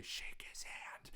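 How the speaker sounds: urgent breathless whisper, no voice at all; mother whispering a nudge to her frozen son; mom's whisper. Retold or imitated. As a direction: hiss it under your breath so only he hears. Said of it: "I just hear my mom say".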